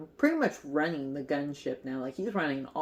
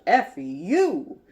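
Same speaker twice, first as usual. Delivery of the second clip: loud, punchy pretend outburst; imitated angry yell; mock fired-up; 3 semitones higher, 9 dB louder than usual. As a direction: hurl it out in playful outrage. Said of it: "he's just fired up".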